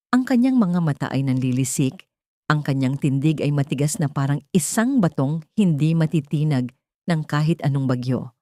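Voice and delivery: plain reading voice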